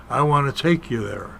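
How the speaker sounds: ordinary talk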